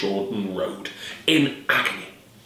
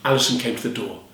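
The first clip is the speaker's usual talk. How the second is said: plain reading voice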